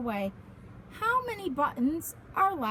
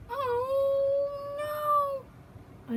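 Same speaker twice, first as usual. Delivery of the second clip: dismayed oh no; 11 semitones higher than usual. Acted. Button pops, dismay.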